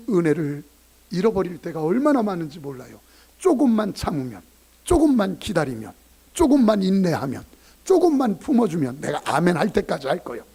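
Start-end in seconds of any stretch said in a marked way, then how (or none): 3.4-10.4 louder and louder repetition; preaching build. Acted. Pastor urging patience, building to a shout. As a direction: build each line more urgent.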